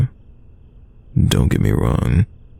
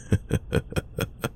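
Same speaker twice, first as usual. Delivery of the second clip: soft laugh; 12 dB quieter than usual. Acted. Laughing at the clueless listener.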